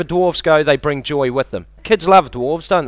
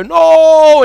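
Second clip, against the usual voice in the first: high, loud anguished cry; loud anguished screen yell; Luke's yell; 13 semitones higher, 10 dB louder than usual. Retold or imitated. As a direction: belt out a Star Wars scream. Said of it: "Luke will go".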